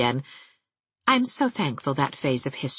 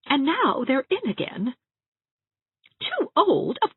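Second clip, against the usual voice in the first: put-on higher, wistful little old lady; timid Aunt Shatty; 9 semitones higher than usual. A narrator's reading. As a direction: ask shyly, hoping for permission. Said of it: "Aunt Shatty crept into my room".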